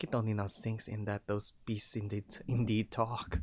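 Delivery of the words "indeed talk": usual speaking voice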